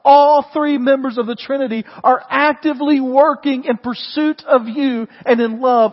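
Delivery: rising pulpit fervor; full-force preaching. Acted. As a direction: drive it home to the congregation. Preacher building to emphasis.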